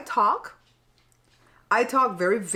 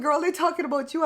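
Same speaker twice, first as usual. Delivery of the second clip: sister teasing; 7 semitones higher than usual. Retold or imitated. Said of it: "My sister said".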